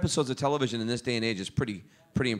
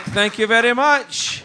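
loud, high, drawn-out thanks; mock triumph; 12 semitones higher, 10 dB louder, slower than usual. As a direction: crow it slowly and grandly for laughs. Triumphant reply to the guard.

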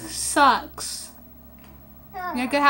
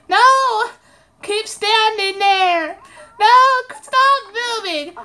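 high loud shout at the screen; desperate shouted plea to the Sim; yelling no; 7 semitones higher, 4 dB louder than usual. Real, not acted. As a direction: stop him moving, shouting. Yelling at Sims character to stay.

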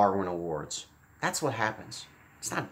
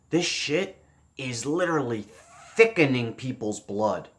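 ordinary talk, nothing marked

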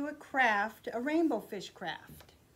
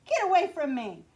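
loud, higher angry order; angry shout; 4 semitones higher, 7 dB louder than usual. Acted. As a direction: drive him off with a loud shout. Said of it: "cried the Rainbow Fish".

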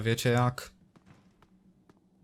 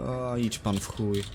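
ordinary talk

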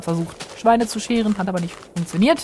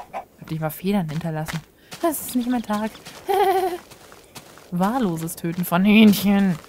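ordinary talk

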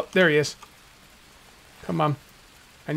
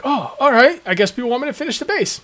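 mock sheepish giving in; 6 semitones higher than usual. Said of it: "And I was like".

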